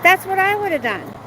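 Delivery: high, loud insistence; That's hit high and loud; emphatic. Real, not acted. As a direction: stress on That's. Emphatic opinion.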